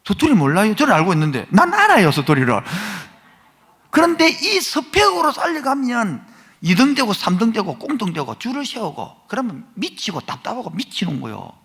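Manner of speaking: loud, high, impassioned sermon climax; preaching at full force. Real, not acted. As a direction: drive it home to the congregation, rising.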